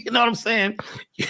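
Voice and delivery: ordinary talk, nothing marked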